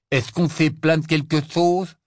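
loud, high, exasperated reply; impatient retort. A narrator's reading. Ron replying to Hermione's plea, exasperated. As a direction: push back with loud impatience.

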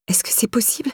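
soft unvoiced whisper. Tearful Laura asking the policewoman to call her mother, whispering. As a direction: ask it in a whisper. Crying Laura asks quietly.

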